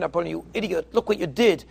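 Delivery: normal talking voice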